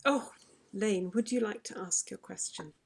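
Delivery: ordinary talk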